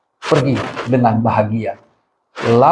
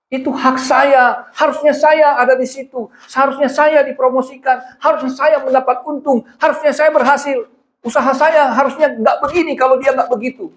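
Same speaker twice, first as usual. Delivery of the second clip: aggrieved complainer; 11 semitones higher than usual. Retold or imitated. Preacher voicing a wronged believer's aggrieved complaint. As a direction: whine about what you were owed.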